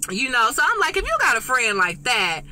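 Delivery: ordinary talk, nothing marked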